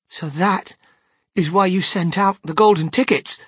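put-on high, loud burst of realisation from a boy; excited cry. A narrator's reading. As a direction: cry it out as it dawns on you. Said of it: "cried Charlie".

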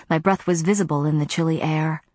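ordinary delivery, nothing marked

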